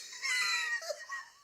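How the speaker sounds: high, loud real laugh; burst of laughter. Real, not acted. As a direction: crack up at the absurdity.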